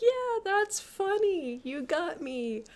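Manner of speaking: slow, drawn-out, high start; humouring her. Retold or imitated. He concedes her joke, slow and humouring. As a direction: play along with an awkward drawl.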